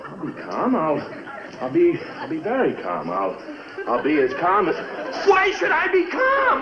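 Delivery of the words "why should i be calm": high, loud outburst; exploding shout. Acted. Husband blowing up at wife over the ring. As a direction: snap from forced calm into a yell.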